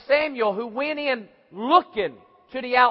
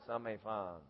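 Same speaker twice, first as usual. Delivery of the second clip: inner thought; 13 semitones lower, 20 dB quieter, faster than usual. Retold or imitated. Inner thought, hidden trouble.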